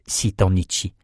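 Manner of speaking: usual speaking voice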